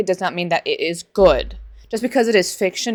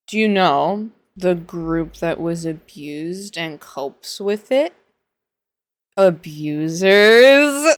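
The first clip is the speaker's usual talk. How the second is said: very slow build to "Abusers"; slow dramatic reveal; much slower than usual. Real, not acted. Pointed question to readers, slow build. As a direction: draw it out, then land the word. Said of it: "rhetorical reveal".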